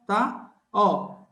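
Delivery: ordinary talk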